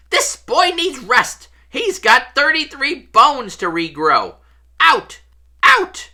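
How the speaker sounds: high, loud scolding shout; angry shouting. A narrator's reading. Matron clearing visitors from her patient's bedside, high-pitched shouting. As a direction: shout them all out of the room. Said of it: "storming over, shouting".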